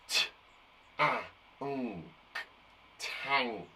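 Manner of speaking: slow sound-by-sound T, a, nk, then tank; segmenting sounds. Shown as a demonstration. Teacher sounding out tank sound by sound for the class. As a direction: stamp out each sound before blending. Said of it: "I'll go first, then you go".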